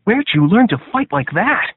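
impressed recruit. A narrator's reading. Recruit asks in awe.

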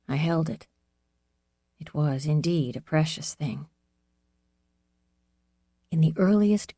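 ordinary delivery, nothing marked

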